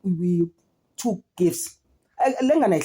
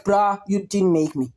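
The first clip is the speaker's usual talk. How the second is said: ordinary talk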